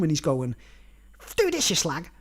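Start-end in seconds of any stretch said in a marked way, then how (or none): 1.4-2.1 lightly voiced leprechaun giving orders; a hint of a rude, bossy leprechaun. Leprechaun ordering a woman around the room, lightly mimicked bossiness. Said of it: "this is the way the leprechaun was talking".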